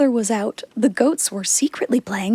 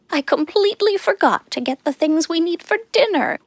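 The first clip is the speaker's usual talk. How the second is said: goat mother; 7 semitones higher than usual. A narrator's reading. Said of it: "she said".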